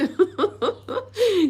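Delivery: a sudden high, loud laugh at her own bad luck; burst of laughter. Real, not acted. Laughing to viewers about the crashed video, loud laugh.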